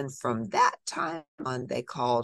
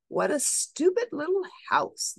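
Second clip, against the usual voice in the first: high, nasty sneer at the little house; sneering stepsisters. Acted. Stepsisters mocking the little house, high sneering voice. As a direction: look down your nose at it.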